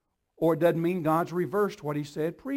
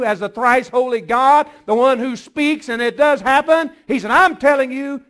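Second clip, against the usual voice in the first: louder, higher, driving preacher voice; full-force preaching; 7 semitones higher, 12 dB louder than usual. Acted. Pastor urging the church to trust God's word, in a loud, fervent preaching voice. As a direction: preach it hard to the congregation.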